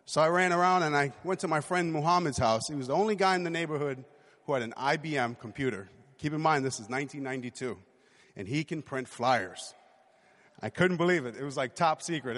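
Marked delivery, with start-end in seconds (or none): none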